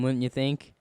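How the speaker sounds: ordinary talk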